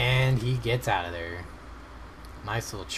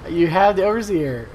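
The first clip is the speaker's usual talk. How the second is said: excited; 8 semitones higher, 9 dB louder than usual. Real, not acted. Urging the player on screen.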